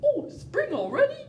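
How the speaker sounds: high surprised bear voice; waking bear. Pretend. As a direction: play the bear just woken up. Sleepy bear answers waking.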